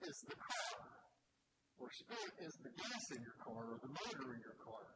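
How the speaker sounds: ordinary talk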